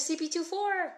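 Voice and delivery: high, loud, excited shout-out of a name; excited call. Real, not acted. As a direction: greet the name with a burst of excitement. Excited greeting of a username.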